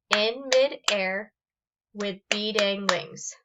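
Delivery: "In mid-air with beat-ing wings" slowly, a beat per syllable. Shown as a demonstration. Teacher counting seven syllables of "In midair with beating wings", slow and separated. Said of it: "how many times am I clapping".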